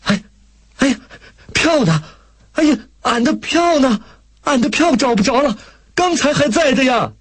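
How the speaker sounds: high-pitched, panicky woman's outcry; alarmed cry. A narrator's reading. Country woman finding her ticket gone at the station, alarmed cry. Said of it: "这是一个女人的惊叫".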